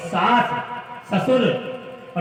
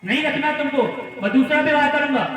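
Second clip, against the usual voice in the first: raised, high-pitched rejection; imitated scolding husband; 8 semitones higher, faster than usual. Husband threatens wife with remarriage, scolding her. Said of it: "तरह तरह से ताड़ना देने लगे".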